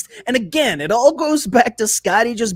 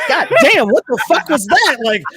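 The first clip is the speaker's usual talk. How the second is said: higher, louder stunned outburst; shocked disbelief; 5 semitones higher, 3 dB louder than usual. Retold or imitated. Imagined listener hit with an absurd claim, stunned outburst. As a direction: react like you can't believe your ears. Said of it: "You'd be like".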